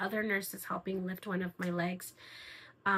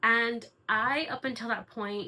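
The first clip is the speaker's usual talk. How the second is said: ordinary talk, nothing marked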